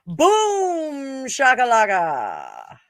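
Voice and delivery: long boom gliding down, slow finish; drawn-out exclamation. Pretend. Celebrating a card, slow swooping exclamation. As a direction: stretch out a playful catchphrase, falling in pitch.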